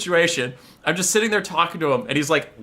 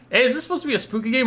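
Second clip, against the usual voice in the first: higher, quick uneasy question; wary question; 8 semitones higher, much faster than usual. Retold or imitated. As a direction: ask it high and fast, suspicious. Acted question, player wary in dark.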